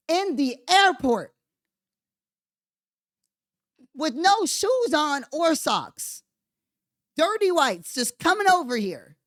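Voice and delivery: lightly voiced sneering snob. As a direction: play a snobbish bigot, mockingly. Mocking bigot voice.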